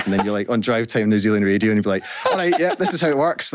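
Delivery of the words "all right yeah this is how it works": breezy and high, laughing through it. Retold or imitated. Founder mimicking himself on New Zealand radio, laughing. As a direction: play it light, amused at the chaos. Acting out a chirpy radio interview.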